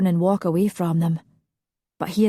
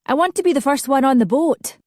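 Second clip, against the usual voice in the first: eager call; 7 semitones higher, 4 dB louder than usual. A narrator's reading. Eager passenger calling out.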